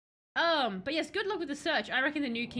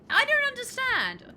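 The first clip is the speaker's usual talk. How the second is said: high, loud cry of disbelief; outraged protest; 12 semitones higher, 7 dB louder than usual. Acted. Newly bereaved heir protests to Eric, loud and outraged. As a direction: burst out loudly, stretching the words. Acted protest in game's king's death.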